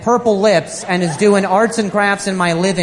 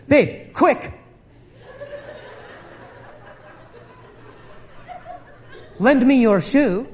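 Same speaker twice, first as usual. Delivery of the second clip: higher, eager plea; mock frantic rescue call; urgent excited wife; 7 semitones higher, slower than usual. Wife urgently asks for shoe.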